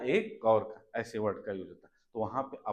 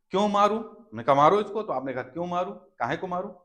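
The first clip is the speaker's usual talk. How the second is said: higher, indignant why should I; mock refusal; 5 semitones higher, 6 dB louder than usual. Acted refusal to kick.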